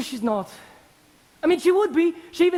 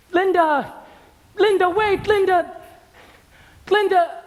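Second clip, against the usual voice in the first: calling out after her; 6 semitones higher, 3 dB louder than usual. Real, not acted. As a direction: raise the voice, call her name after her. Calling after departing girl.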